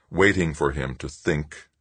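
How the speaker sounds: usual speaking voice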